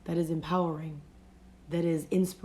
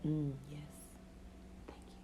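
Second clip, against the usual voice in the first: softer, gentle murmur of approval; a kind inner voice quietly saying thanks; soothing inner voice; 3 semitones lower, 14 dB quieter, faster than usual. Retold or imitated. Soothing inner voice to herself, soft. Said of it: "not that soothing voice that you're like".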